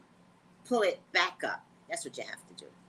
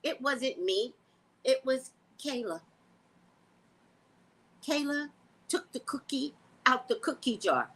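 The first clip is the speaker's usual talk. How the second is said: put-on toddler blaming; 5 semitones higher than usual. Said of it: "they will blame their infant brother".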